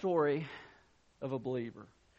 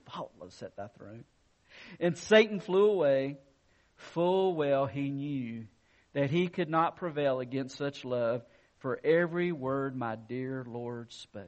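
poem recital; 4 semitones lower than usual. Acted. Reads a gospel poem.

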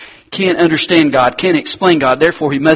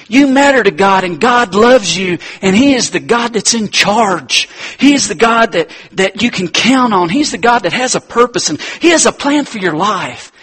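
preaching; 5 semitones higher, 4 dB louder than usual. Acted. Preacher affirming God's care.

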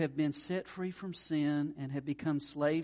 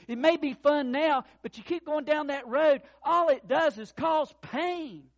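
loud, higher sermon climax; preaching at full force; 10 semitones higher, 9 dB louder than usual. Acted. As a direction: preach it, warn them hard.

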